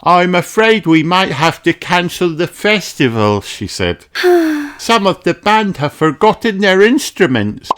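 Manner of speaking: fretting shopkeeper rabbit; Miss Rabbit voice. Acted.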